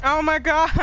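loud, high alarm; panicked shout. Real, not acted. Streamer yells to teammates as the enemy breaches, panicked shout. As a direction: blurt it out as the enemy breaks in.

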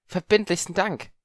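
bow with the voice; theatrical courtly gratitude; flourishing thanks. Acted. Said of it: "verbeugte sich schwungvoll".

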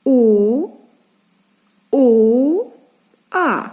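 long, separate "О, о, а"; isolated vowels. Shown as a demonstration. Teacher naming vowel sounds, "О, о, а" held one by one. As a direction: sustain each vowel of "О, о, а" with pauses between. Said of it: "назвемо голосні звуки".

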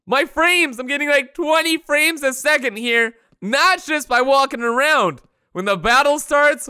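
ordinary delivery

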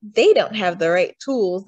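plain reading voice

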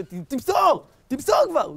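high, loud player's call; acted impatient shout; shouting on the pitch. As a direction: yell for a pass like a footballer. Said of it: "התחיל לצעוק ההוא בחוץ".

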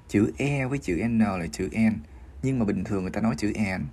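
normal talking voice

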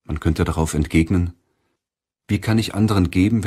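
ordinary talk, nothing marked

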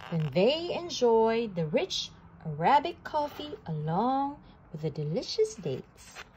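ordinary delivery

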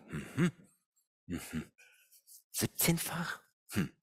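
"Siebzehnfach?" echoed softly with a doubting rise; sceptical echo. Retold or imitated. Coach doubts colleague's deal, soft sceptical echo. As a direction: quietly repeat "Siebzehnfach?" as if you don't buy it. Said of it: "Da habe ich mir gedacht".

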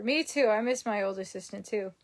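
ordinary talk, nothing marked

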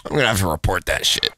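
low-pitched mimicry of a complaint; imitated complainer. Gamer repeating another player's threat, imitated grumble. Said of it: "Just the way he said it".

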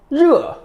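르 falling sharply from high; fourth tone. Shown as a demonstration. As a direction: drop 르 fast from the top. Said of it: "사성".

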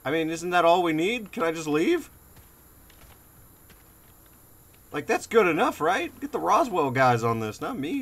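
high, loud frantic pleading; panicked shouting. Real, not acted. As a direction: beg loudly to escape. Panicked pleading in horror game.

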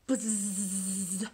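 a long buzzing bee sound. Shown as a demonstration. Teacher shows kids the bee motion, buzzing. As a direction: buzz like a bumblebee for the kids. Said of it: "you have to really do that one".

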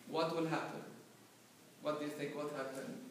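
ordinary talk

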